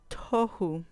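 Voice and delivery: "tohu" stressed on TO; stress on first syllable. Shown as a demonstration. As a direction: say "tohu" as TO-hu, falling away after TO. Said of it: "ahí va a caer el acento". ("tohu" con acento en la primera sílaba)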